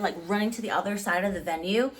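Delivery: ordinary talk, nothing marked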